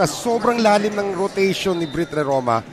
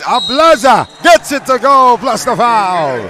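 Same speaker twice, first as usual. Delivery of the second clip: excited; 7 semitones higher, 9 dB louder, slower than usual. Real, not acted. And-one call, play-by-play.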